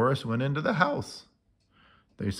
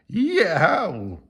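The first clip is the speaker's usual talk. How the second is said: high frightened yelp; scared yelp; 8 semitones higher, 6 dB louder than usual. A narrator's reading. Morris spooked by the costumed kids, scared yelp.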